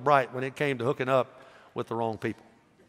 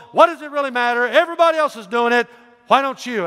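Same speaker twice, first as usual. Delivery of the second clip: higher, louder coaxing preacher voice; pushy messenger; 8 semitones higher, 6 dB louder than usual. Acted. Messenger urging Micaiah to preach favorably, loud and pushy. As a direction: lean on him to go along, loud and high.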